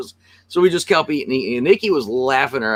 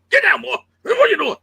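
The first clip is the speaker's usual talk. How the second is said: much higher, playful scolding; mock scolding a pet; 3 dB louder, faster than usual. A host pretending to wrestle down a toy, scolding it like a dog. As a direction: talk to the toy like an unruly dog.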